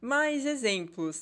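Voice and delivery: ordinary talk, nothing marked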